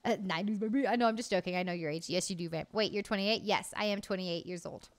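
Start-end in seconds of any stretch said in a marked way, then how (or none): none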